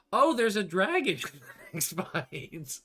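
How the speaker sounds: high, loud, slow exclamation breaking into a laugh; mock excited cry. Player imagines learning of the dragon early, mock excited cry. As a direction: cry out in play-acted astonishment. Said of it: "I would have been like".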